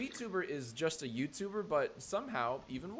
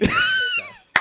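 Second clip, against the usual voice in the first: squeaky mock announcement; 13 dB louder, slower than usual. Mock summons to sit down.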